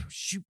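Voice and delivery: whooshing "shoop"; swipe sound effect. Pretend. Game master changes scene, imitated swipe noise. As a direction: make a quick spoken swoosh.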